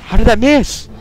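high, loud incredulous cry; outraged disbelief. Real, not acted. Gamer cries out at missed shot, high.

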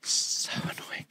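slow, voiceless whisper, playfully exasperated; whispered so annoying. Retold or imitated. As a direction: breathe it out slow as a mock sigh. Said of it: "he just goes".

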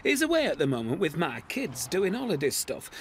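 normal talking voice

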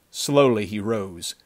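ordinary delivery

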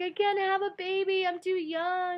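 retold panicked crying, high and loud. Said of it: "I just started crying and crying".

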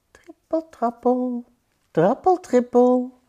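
high, playful patter of tiny feet; pattering mouse feet. A narrator's reading. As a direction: make the words patter, pitched high. Narrator imitates pattering mouse feet. (hoog trippelend als muizenpootjes)

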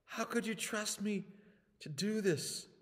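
plead, overwhelmed by failure; guilt-ridden plea. Acted. Peter pleads in his guilt.

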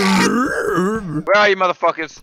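zombie voice. Pretend. Zombie player hunting others.

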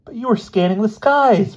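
high, loud protest; howling. A narrator's reading. Boy defending his roommate's alibi, high loud howl. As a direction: howl it in outrage. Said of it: "Bruno howled".

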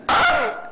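sharp high ah of disbelief; shrill shocked ah; high exclamation. Real, not acted. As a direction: yelp it high. Sudden exclamation.

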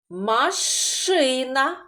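drawn-out word for the class; slow teacher's sounding out; slowly sounded out. Shown as a demonstration. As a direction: stretch the word so children hear each sound. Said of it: "В цьому слові де знаходиться звук Ш?".

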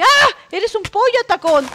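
startled yell, high and loud; grito de susto. Real, not acted. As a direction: cry out at a sudden attack. Startled by attack in game. (grito sobresaltado, agudo y fuerte)

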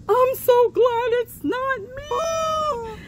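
squeaky chicken begging the dog. Pretend. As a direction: plead in a tiny high chicken voice. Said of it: "And the chicken says".

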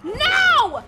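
long, loud, high "no"; anguished yell; horrified shout. Real, not acted. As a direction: wail it in disbelief. Horror at game event.

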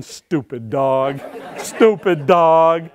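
slow, drawn-out mock scolding. Handler to the dog that was right, mock scolding. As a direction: drag out each word as a rueful scold. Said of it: "And I'm going".

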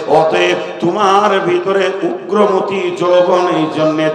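loud sing-song sermon chant; full-force preaching. Acted. (উচ্চস্বরে সুরেলা ওয়াজ)

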